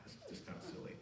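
usual speaking voice

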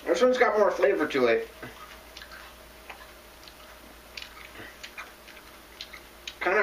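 talking between bites of leaf; chewing while talking. Real, not acted. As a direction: mouth partly full of greens. Seen 2: Bites leaf before, holds greens at mouth, cheeks working while speaking.

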